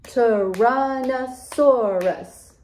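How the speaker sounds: Tyrannosaurus stretched slowly into five beats; Tyrannosaurus, slowly split for counting; syllable by syllable. Shown as a demonstration. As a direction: draw out each syllable of Tyrannosaurus evenly. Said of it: "Let's count how many syllables".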